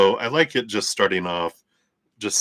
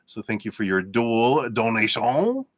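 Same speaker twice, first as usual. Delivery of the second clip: mock French accent on the thanks; hammed-up French accent thanking a donor; 3 semitones higher than usual. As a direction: put on a playful French accent. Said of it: "That's my French accent".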